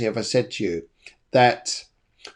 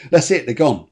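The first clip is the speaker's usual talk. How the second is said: ordinary delivery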